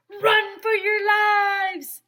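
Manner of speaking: high, loud, slow alarm shout; loud high shout to flee; panicked shout. A narrator's reading. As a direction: bellow a warning to everyone. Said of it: "shouted Neil".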